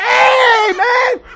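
high, loud shout down from the tree; a sharp high shout; yelling hey. Retold or imitated. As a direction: holler at them to stop. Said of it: "We're like".

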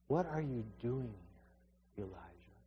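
God asking softly. Acted. God questions Elijah softly.

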